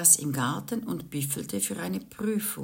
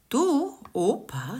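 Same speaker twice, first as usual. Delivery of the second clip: put-on higher, slow wheedling child voice; Tiffany wheedling grandpa, lightly voiced little girl; 7 semitones higher, slower than usual. A narrator's reading. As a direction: coax grandpa sweetly. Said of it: "sagte sie".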